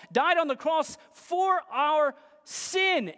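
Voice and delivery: emphatic preaching. Acted. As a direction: pile up each point with conviction. Preaches the gospel summary emphatically.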